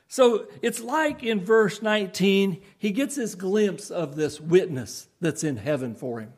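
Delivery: usual speaking voice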